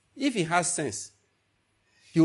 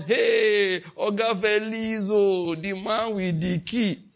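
mock flattery; 5 semitones higher, much slower than usual. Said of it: "So it's not about".

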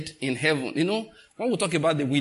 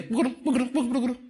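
high bumpy rattling noise buguru buguru; playful car-rattle sound effect; imitated rattle; 10 semitones higher, much faster than usual. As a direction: mimic the car clattering over bumps. Imitating car rattling over rough road.

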